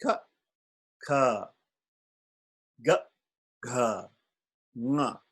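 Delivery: ka kha ga gha nga recited in order; first consonant family. Shown as a demonstration. Teacher reciting the first family of consonants. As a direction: recite the set clearly. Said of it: "You wanna hear me say it again?".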